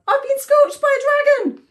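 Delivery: high, loud, frantic bird; fast, high storybook panic; panicked cry. A narrator's reading. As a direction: gabble in shrieking alarm. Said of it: "cried Warbler".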